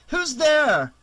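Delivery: high, loud challenge into the dark; calling out. A narrator's reading. Girl hears leaves crunch outside, calling out. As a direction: demand an answer from someone unseen.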